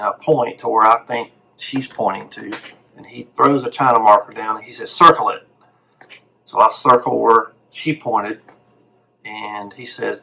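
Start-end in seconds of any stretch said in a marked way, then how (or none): 4.9-5.5 higher and louder, short and sharp; curt doctor command. Retold or imitated. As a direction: snap the order at the student. Retold doctor demanding a circle.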